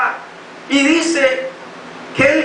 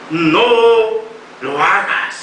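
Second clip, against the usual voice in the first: divine voice; 6 semitones lower, slower than usual. A narrator's reading. Divine voice stops him.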